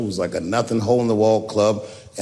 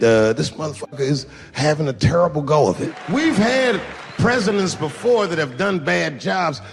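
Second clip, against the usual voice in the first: ordinary delivery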